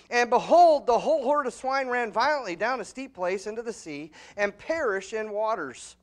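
thundering Bible reading; preaching scripture. Acted. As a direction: proclaim the verses from the pulpit. Loud scripture reading in sermon.

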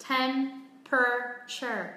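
Temperature in three slow, evenly weighted syllables; very slow, separated syllables in Temperature; no word stress. Shown as a demonstration. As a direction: sound out tem-pruh-cher for Temperature, stressing none. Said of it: "đang đọc không có trọng âm".

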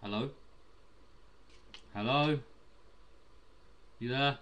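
ordinary talk

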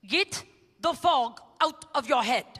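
put-on slow German-accented line, fog sounding rude. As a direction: imitate the German speaker saying fog. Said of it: "It's a German accent".

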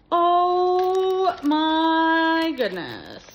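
slow awed gush over a gift; drawn-out awe. Real, not acted. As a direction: stretch it out, overwhelmed with joy. Unboxing Alice in Wonderland gifts.

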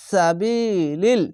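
সাবিলিল stretched slowly; slow drawn recitation. Shown as a demonstration. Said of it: "টেনে পড়বেন". (সাবিলিল ধীরে টেনে)

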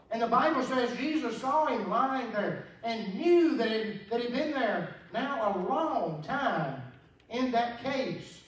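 high, loud, drawn-out preaching; full force preaching. Acted. Preacher telling the congregation the Bible story, in full cry. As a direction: lean into the gospel story.

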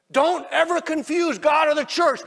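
high, loud impassioned sermon shout; slowing, hammered-out pulpit shout; full-force preaching. Real, not acted. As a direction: preach it at the top of your voice.

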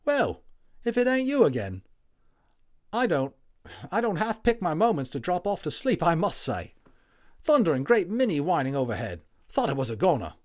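a WWI orderly waking up chatty; friendly Cockney Tommy, loud and high; cheery Cockney medic. Acted. Tommy the soldier speaks.

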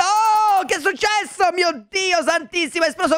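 high alarmed exclamation; startled. Real, not acted. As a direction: gasp it out after the explosion. Something exploded suddenly.

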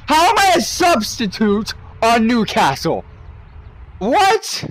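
high, incredulous questioning; shocked high What at the squad screen; baffled disbelief. Real, not acted. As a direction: sound utterly baffled by the lineup. Confused about substitute status.

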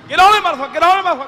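high, loud yell at the table; loud, high trash-talk at bid whist; heated card shout. Retold or imitated. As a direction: slam it down at the card game.

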